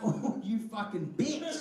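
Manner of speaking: slow, laughing muttered curse; inner curse. Retold or imitated. Comedian curses the heckler in his head, slowly. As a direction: drag out the insult under your breath. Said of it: "in my head".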